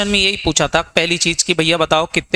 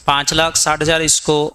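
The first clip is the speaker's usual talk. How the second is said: ordinary delivery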